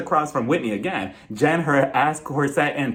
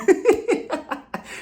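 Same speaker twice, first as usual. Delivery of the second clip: high airy burst of laughter; breathy laugh. Real, not acted. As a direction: laugh under your breath. Laughs at own joke.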